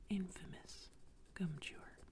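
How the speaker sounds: plain reading voice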